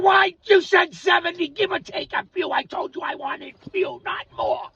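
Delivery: arguing with God about the years; retold angry complaint to God. Angry man complaining to God about the years. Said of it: "I said".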